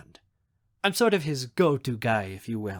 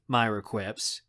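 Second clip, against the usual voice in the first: ordinary delivery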